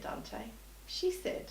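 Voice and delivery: usual speaking voice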